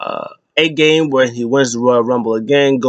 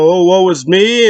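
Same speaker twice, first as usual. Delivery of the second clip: slow, higher mocking whine; mock whining; 6 semitones higher, 4 dB louder, slower than usual. Gunther mocking Seth's complaining, slow mock whine. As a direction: sneer at his complaining, drawn out. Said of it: "He was like".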